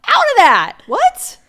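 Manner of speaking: loud, high-pitched disbelief; indignant outburst. Real, not acted. Co-host reacting to the caller's relationship, indignant outburst. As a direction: burst out, shooting up high on the last word.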